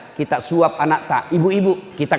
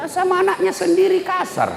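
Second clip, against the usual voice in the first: mocking exclamation. Real, not acted. Mocking harsh parents.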